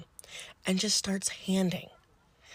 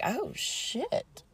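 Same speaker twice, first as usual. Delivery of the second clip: high, softened oh-no moment; softly alarmed, pitched up; dawning realization; 8 semitones higher, 5 dB quieter than usual. Retold or imitated. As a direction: let the trouble dawn on you, voice lifting. Teller's reaction to missing patient.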